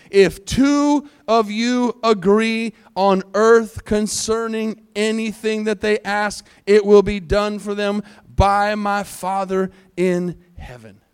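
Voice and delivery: slow, forceful verse proclamation; measured, emphatic pulpit reading; scripture reading. Acted. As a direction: spell out the verse deliberately, word by word. Reads scripture aloud.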